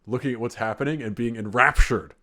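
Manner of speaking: ordinary delivery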